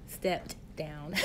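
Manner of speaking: "stepped down" said slowly, each sound crisp and deliberate; enunciated. Shown as a demonstration. Host enunciating for her live viewers. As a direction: spell out "stepped down" clearly, stressing "stepped". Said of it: "allow me to enunciate".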